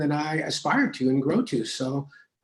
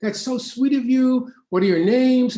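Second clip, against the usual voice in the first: gushing thanks; 10 semitones higher, 6 dB louder than usual. Retold or imitated. Gushing reply to fans.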